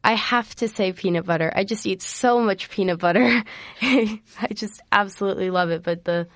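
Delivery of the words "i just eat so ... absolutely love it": ordinary delivery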